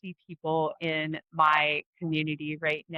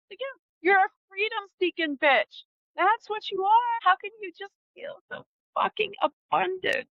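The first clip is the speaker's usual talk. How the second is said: high sneering mock voice, much higher; mocking critic voice; 13 semitones higher than usual. She mocks people who call her greedy, in a high sneering voice.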